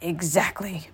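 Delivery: put-on soft old man's mutter. A narrator's reading. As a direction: mutter it under your breath. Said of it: "muttered the old man".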